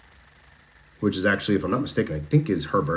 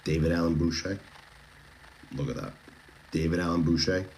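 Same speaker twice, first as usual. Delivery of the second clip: bedtime magic voice; 3 semitones lower, 6 dB quieter than usual. Shown as a demonstration. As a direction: lower and much softer, smooth radio DJ. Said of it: "this is my bedtime magic voice".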